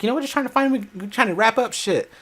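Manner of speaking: high lightly voiced aunt; a hint of the aunt's high voice; imitating aunt. Aunt explaining the search, lightly voiced. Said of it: "She's like".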